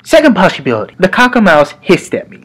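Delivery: normal talking voice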